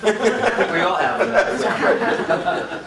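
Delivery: slow, laughing line. Real, not acted. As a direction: laugh it out slowly. Laughing at the uncle joke.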